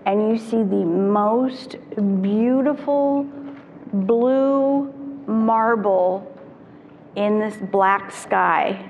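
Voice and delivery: slowed right down in hushed wonder, stretching beautiful blue marble; retelling an astronaut's awe, drawn out and reverent; awed slow quote. Retold or imitated. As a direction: let each word land like seeing Earth from space. Quoting Sally Ride's awe at Earth.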